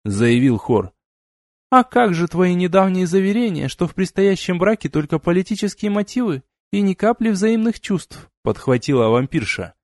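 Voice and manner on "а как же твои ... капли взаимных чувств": high-pitched mock questioning; teasing. A narrator's reading. Vampire girl picks up the joke about the political marriage, high and teasing. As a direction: needle him in a raised female character voice.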